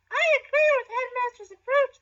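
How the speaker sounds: naggy know-it-all voice, very high and loud; Hermione Granger. Acted. Pupil siding with the headmaster, naggy high voice. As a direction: pipe up in a squeaky, nagging brainy voice. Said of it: "said Hermione in a naggy intelligence".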